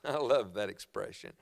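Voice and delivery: ordinary talk, nothing marked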